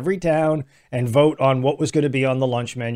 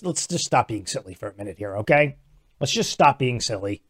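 quiet scolding; 6 semitones lower, 5 dB quieter than usual. Real, not acted. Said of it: "telling listeners off".

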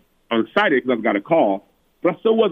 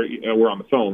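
ordinary talk

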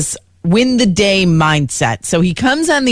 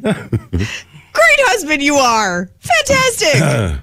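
sarcastic; 5 semitones higher, much slower than usual. Acted. Mocking husband who let wife down.

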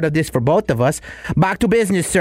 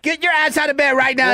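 high-pitched barked command; drill sergeant; 10 semitones higher than usual. Acted. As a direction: bark it at a lazy recruit. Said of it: "Drill Sergeant Nacho Chavez".

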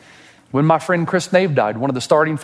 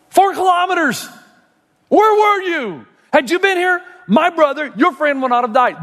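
much higher, crying out where were you; anguished Mary. Acted. Preacher voicing Mary crying out at Jesus, high and anguished. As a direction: cry it up high like a grieving sister.

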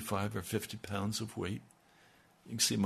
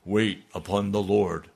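magisterial voice; 6 dB louder than usual. Retold or imitated. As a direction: pronounce it like a divine command. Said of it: "he said it in a magisterial voice".

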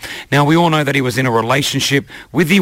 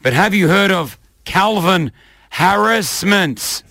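trailer voice; 4 semitones higher than usual. Acted. Comic trailer announcement.

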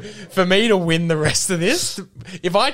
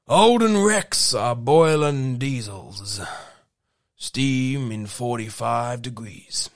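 slow country Australian with English twang; measured, low Aussie drawl with a British hint; Mildura country accent; 4 semitones lower, slower than usual. Acted. Reading lyrics as a middle-aged Mildura local, country Australian accent. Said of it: "I can't stop this voice".

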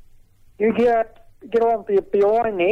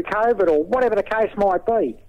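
ordinary delivery

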